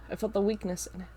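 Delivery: usual speaking voice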